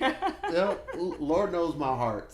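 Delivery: high, loud, through laughter; laughing. Real, not acted. Joking about borrowed church speakers, laughing as he speaks. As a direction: talk through a burst of laughter.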